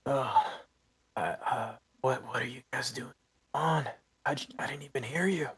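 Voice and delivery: put-on halting old-timer voice, starting high; flustered old farmer. Blind farmer explains the commotion to intruders, flustered and halting. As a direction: stammer along as a bewildered farmer.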